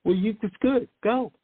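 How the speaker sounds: usual speaking voice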